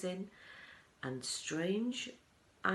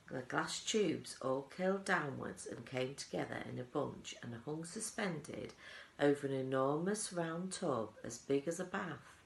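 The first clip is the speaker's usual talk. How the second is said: plain reading voice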